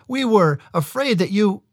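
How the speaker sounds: high, anxious, cut off; urgent young brother. A narrator's reading. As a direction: blurt it out, then stop short. Brother cut off mid-warning.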